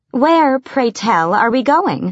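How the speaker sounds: slow, coy and sugary; simpering. Acted. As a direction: simper up at him sweetly. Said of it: "don't simper at me".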